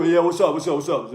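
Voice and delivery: low comic greeting, dropping in pitch; mock low-voiced small guy; small guy's deep voice. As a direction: play a little man with a surprisingly deep voice. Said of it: "you know, small people, and they go".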